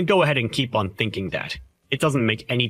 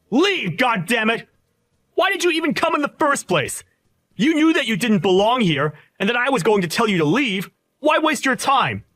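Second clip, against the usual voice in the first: furious shout; 9 semitones higher than usual. Acted. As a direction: scream him out of your wedding. Groom screaming him out of wedding.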